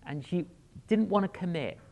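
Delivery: ordinary talk, nothing marked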